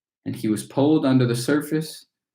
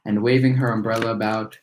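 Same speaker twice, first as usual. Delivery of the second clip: usual speaking voice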